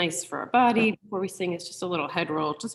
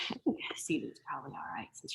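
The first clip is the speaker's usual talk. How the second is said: whisper; 5 semitones lower, 11 dB quieter than usual. Real, not acted. Aside about sitting.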